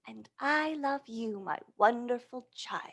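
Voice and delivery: soft, hushed and loving, ending in a whisper; a soft goodnight whisper to a child; tender whisper. Acted. Mother answering child at bedtime.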